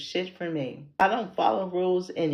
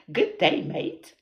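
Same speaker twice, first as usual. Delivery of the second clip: louder, tentative Australian greeting; Aussie accent attempt; 6 dB louder, slower than usual. Shown as a demonstration. Checking with viewers she said it right, Aussie accent attempt. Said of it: "in an Aussie accent".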